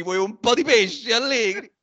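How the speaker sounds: put-on high cheeky Neapolitan street kid; neapolitan street slang. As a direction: tease like a Naples street urchin. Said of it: "E gli avrei fatto dire". (scugnizzo napoletano sfrontato)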